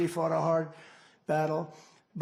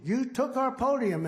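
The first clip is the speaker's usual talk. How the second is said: mock scream; 8 semitones higher than usual. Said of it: "the governor was screaming".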